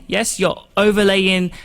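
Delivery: ordinary delivery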